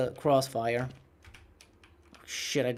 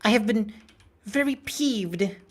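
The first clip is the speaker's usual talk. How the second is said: higher-pitched, halting mock log entry; captain's log; 7 semitones higher than usual. Gamer recording a pretend captain's log, peeved. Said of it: "Captain's log".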